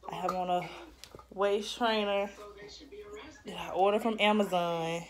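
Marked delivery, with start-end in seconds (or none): none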